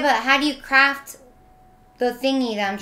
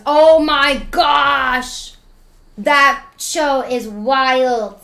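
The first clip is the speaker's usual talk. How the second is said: very slow, louder, drawn-out gush; 5 dB louder, much slower than usual. Real, not acted. Streamer raves to chat about a show, drawn-out gush. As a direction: stretch every word out in awe. Gushing about Squid Games.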